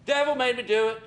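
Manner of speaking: a hint of an excusing wife; woman's excuse. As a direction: toss it off like a guilty wife. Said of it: "the woman does the same thing".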